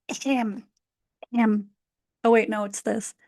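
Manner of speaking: ordinary talk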